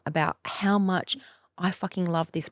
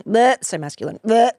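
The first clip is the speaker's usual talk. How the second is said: louder bleh, scoffing; dismissive scoff; mock disgust; 4 semitones higher, 9 dB louder than usual. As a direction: spit out the bleh in distaste.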